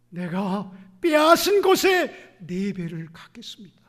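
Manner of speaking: shouted vow. Acted.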